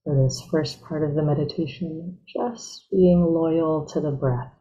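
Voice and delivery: guided meditation. Real, not acted. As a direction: ease them gently into stillness, unhurried.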